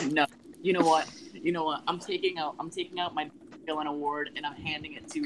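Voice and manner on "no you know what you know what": normal talking voice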